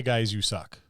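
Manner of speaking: ordinary talk, nothing marked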